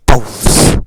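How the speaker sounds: sudden loud curse; real burst of annoyance; exasperated outburst. Real, not acted. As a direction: blurt it out loudly at a mishap. Curses a mishap while driving in game.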